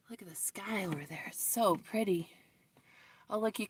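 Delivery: fast, soft whisper; hushing while pointing at the sky. Real, not acted. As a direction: breathe it out quietly. Hushed remark on the sky.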